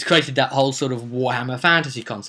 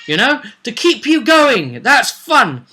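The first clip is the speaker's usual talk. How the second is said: normal talking voice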